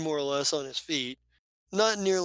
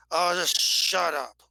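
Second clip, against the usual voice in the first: fed up; 3 dB louder, slower than usual. Acted. GM voices fed-up companion.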